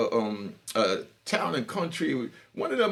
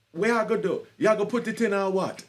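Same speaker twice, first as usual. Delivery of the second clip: put-on Jamaican accent; 5 semitones higher than usual. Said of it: "She was a Jamaican".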